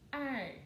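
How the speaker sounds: ar as one drawn syllable; sound of ar. Shown as a demonstration. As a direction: sound out ar as a single ending. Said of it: "que tem o som de". (o som de ar numa sílaba só)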